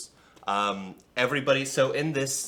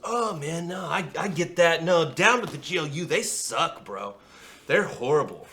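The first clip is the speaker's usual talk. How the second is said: laid-back dude; 7 semitones higher, 5 dB louder than usual. Acted. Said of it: "He goes".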